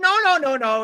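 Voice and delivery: high, loud mock interruption; frantic objection. [name] tries to stop Jen talking, frantic mock yelling. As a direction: cut in with repeated high noes.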